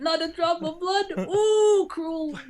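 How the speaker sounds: high gloating villain voice; gleeful, squeaky villain; Shylock voice. Retold or imitated. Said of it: "Shylock's like".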